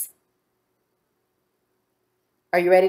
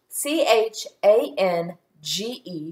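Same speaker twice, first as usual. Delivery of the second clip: name each letter separately; measured classroom spelling. Shown as a demonstration. Spelling the word aloud.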